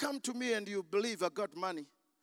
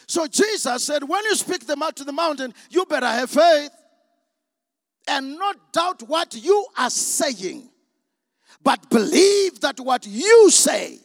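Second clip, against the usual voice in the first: very loud, higher, with drawn-out key words; full-force preaching; 5 semitones higher, 14 dB louder than usual. Real, not acted. Preacher urges the congregation to have faith, at full force. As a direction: thunder it out like a revival preacher.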